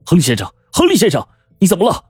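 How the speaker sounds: shouting to rouse a dying man; frantic calling. Acted.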